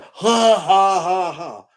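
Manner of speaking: mock cackle, fading at the end; hammed-up ha-ha-ha; imitated laughing. Mimicking the congregation laughing along, mock hysterical cackle.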